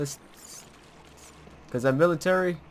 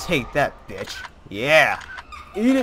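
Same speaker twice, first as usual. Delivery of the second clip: triumphant yelling; 5 semitones higher, 9 dB louder than usual. Real, not acted. Fighting off ghost.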